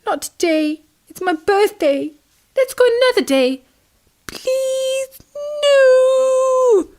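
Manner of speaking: high, tearful begging; little tadpole crying and pleading; sobbing plea. Acted. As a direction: beg your mum through tears. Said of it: "heard little Tim's sobs".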